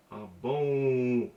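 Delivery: "Ah, bon" with the vowel of "bon" pushed through the nose; nasal sound. Shown as a demonstration. Teacher demonstrating the nasal N, air through the nose. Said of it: "let the air out of your nose".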